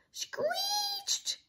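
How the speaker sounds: piercingly high bird cry; robin screech. A narrator's reading. Robin answers the girls' song, screeching. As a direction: screech it thinly like an upset bird. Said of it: "cried the robin".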